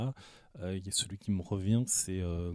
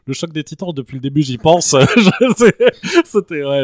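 loud laughter through the words; laughing; 7 semitones higher, 20 dB louder than usual. Real, not acted. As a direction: crack up while talking. Bursts out laughing mid-sentence.

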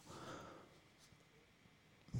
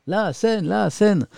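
much higher, teasing chant of the chat; high playful mock chant; mock viewers yelling; 9 semitones higher, slower than usual. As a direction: parrot the viewers' nagging. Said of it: "vous me criez dessus".

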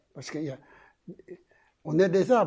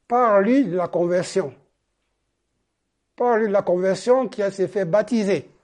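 louder, higher quoted command; emphatic command; 4 semitones higher, 8 dB louder than usual. Retold or imitated. Holy Spirit telling the preacher to speak, emphatic command. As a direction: give the order firmly and loudly.

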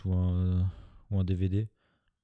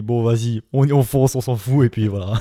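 loud mock quote; 8 dB louder than usual. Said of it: "ils se sont dit".

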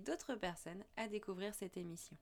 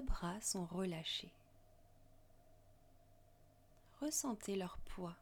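guided meditation. Acted. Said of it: "Je vous invite à suivre ma voix lors d'une méditation".

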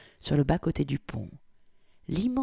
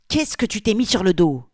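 loud, higher outburst of disbelief; sudden exclamation; 6 semitones higher, 8 dB louder than usual. A narrator's reading. Polly bursting out at Victoria's dress, loud and shocked. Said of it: "s'exclama-t-il soudain".

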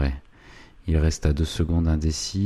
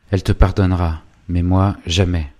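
angry refusal; 2 semitones higher, 7 dB louder than usual. A narrator's reading. Savinien refuses to forgive Goupil.

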